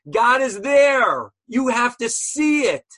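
loud, high, drawn-out declaration; emphatic proclamation. Real, not acted. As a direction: proclaim it with full conviction. Preaching God's hidden presence emphatically.

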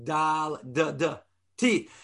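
"Dal, da, da, T" tapped on the tongue; quick tongue-tip demo; tongue letter demo. Shown as a demonstration. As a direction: demonstrate the tongue sounds. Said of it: "Daled is a tongue letter".

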